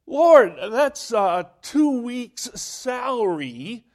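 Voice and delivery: loud, high cry of protest to the Lord. Retold or imitated. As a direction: cry out in disbelief at the sum. Pastor protests to God.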